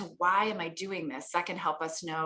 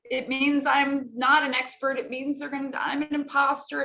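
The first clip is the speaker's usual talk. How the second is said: mock panic; 7 semitones higher, 4 dB louder than usual. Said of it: "they get nervous about being nervous".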